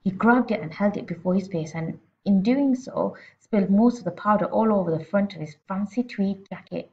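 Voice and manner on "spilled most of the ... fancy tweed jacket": ordinary delivery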